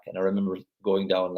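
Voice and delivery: ordinary talk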